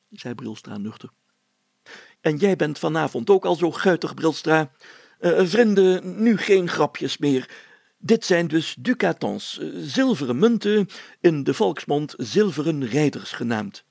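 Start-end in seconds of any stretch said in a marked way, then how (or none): 2.2-13.8 high, loud self-important mayor, slowing to explain; pompous mayor. A narrator's reading. Mayor addresses friends.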